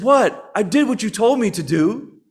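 put-on high, loud protesting child voice; whiny kid. Kid protesting to the parent that the bed is made, mock indignant.

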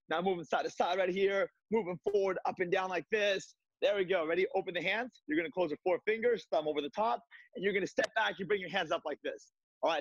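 fitness class cues. Acted. Instructor leading host through a virtual kickboxing class, upbeat coaching. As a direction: cheer the class through each move.

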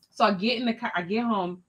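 ordinary talk, nothing marked

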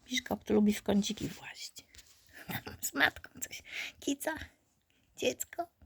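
soft whispered aside. Real, not acted. Whispering about the puppy by its mother. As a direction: keep it down near the litter. Whispering beside sleeping litter.

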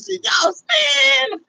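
high mock crying shout; mock crybaby wail. Mocking cry.

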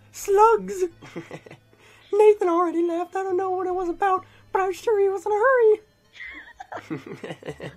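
very high-pitched mock mom voice; game mother. Player reading the mother's lines to Slugs, high mock mom voice. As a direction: squeak it out as a put-on mother. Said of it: "am I doing the mother then?".

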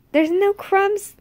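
high, loud, slow disbelief; can't believe there's nothing left; incredulous. Real, not acted. Incredulous at the dog.

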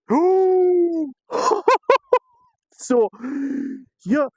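high-pitched whoop breaking into laughter; real excited shouting. Real, not acted. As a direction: cry out in overjoyed disbelief. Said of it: "Покричав тільки що".

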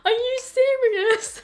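high, slow, tearful disbelief; stunned disbelief. Retold or imitated. To her kneeling boyfriend, high stunned disbelief. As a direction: ask it as if you can't believe it. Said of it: "I just kept saying to him".

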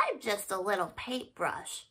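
small, sad hushed admission; sad whisper. Acted. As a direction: whisper it feeling tiny.

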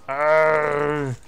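loud gamer arrgh of frustration; frustrated growl. Real, not acted. Gamer losing a fight, frustrated arrgh. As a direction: growl out your annoyance.

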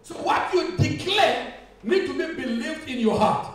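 loud, emphatic preacher with drawn-out words; preaching. Real, not acted. Preacher quotes scripture to the congregation, emphatic preaching. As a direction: proclaim scripture slowly and forcefully.

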